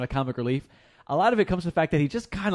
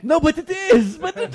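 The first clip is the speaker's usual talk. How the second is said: mock Middle Earth accent; 7 dB louder, slower than usual.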